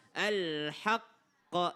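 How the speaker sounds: slow AL-HAQQA with a held double q; drawn-out AL-HAQQA, firm tasydid; doubled letter. Shown as a demonstration. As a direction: hold the Q in AL-HAQQA before release. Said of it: "belajar tasydid, jadi double".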